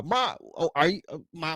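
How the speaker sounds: normal talking voice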